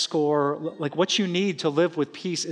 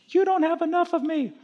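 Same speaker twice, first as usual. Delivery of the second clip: very high, put-on talking-wallet voice; comic high-pitched voice of fretful money; 11 semitones higher than usual. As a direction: squeak like a nagging, worrying wallet. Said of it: "do the impression of money".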